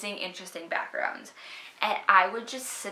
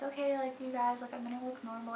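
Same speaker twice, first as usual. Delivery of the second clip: softer, unsure camera voice; nervous and quiet; 3 semitones higher, 8 dB quieter than usual. Retold or imitated. Her old self facing the camera, softer nervous start. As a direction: shrink back, hesitant.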